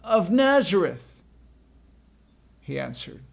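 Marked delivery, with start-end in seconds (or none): 0.0-1.2 high, loud answer from the band; raised voice, pitched up; shouted reply. Acted. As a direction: call the name back forcefully.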